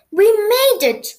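high, loud gasp of relief; gasped exclamation. A narrator's reading. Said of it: "gasped Missus Skittle".

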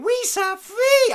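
very high, slow shouted alien voice; Jar Jar Binks voice. Retold or imitated. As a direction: squeal it like a goofy alien. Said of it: "where you hear Jar Jar Binks saying".

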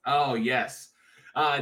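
ordinary talk, nothing marked

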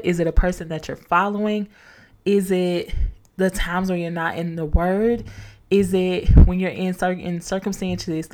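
usual speaking voice

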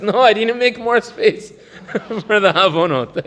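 high mock sinner voice, laughing through it. Teacher imitates the chest-pounding sinner again, high and laughing.

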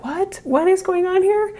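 high, loud shocked what; shocked disbelief. Retold or imitated. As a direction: retell your own shock, loud. Retold shocked reaction.

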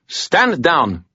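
high, sharp command; imperious, high-pitched authority; snapped order. A narrator's reading. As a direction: snap out the order. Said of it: "the girl snapped".

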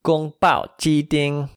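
宫保鸡丁 said slowly, high and level except a falling 保; first and fourth tones. Shown as a demonstration. Teacher models kung pao chicken, 宫保鸡丁 slow with clear tones. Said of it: "G O A N G in the first tone".

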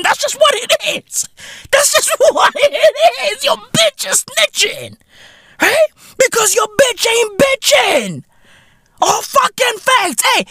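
wailing high in mock outrage; hammed-up squealing hype; high-pitched comic rant. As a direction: crow it way up high, stretching words. Comic rant about snitching.

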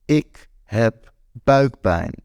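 slow, drawn-out tearful whimper; a light hint of a girlfriend in tears; through tears. A narrator's reading. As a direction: say it slowly, on the edge of crying. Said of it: "door haar tranen heen".

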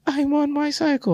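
high-pitched, softish mock excuse; imitating wife. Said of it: "she be like".

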